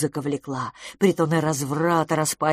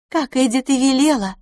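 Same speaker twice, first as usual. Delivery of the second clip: higher, louder character voice; approving lady friend; 7 semitones higher, 5 dB louder than usual. Acted. Lady admiring her guest's outfit, approving.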